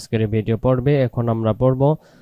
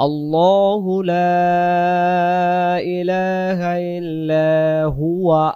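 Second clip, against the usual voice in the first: slow chanted recitation, long drawn vowels; Quran recitation; 4 semitones higher, much slower than usual. Shown as a demonstration. Teacher reciting Ayatul Kursi to students, slow chant. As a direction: chant each word slowly and melodiously.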